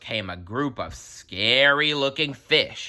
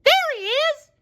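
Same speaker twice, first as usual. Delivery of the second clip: much higher, louder cry of discovery; excited exclamation; 5 dB louder than usual. A narrator's reading. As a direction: shout it out, thrilled. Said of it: "exclaimed Sandy".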